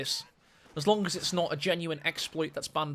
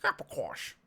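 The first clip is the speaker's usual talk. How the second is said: failed Dutch accent; 6 semitones lower, 7 dB quieter than usual. Shown as a demonstration. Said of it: "trying to say in a Dutch accent there. It failed".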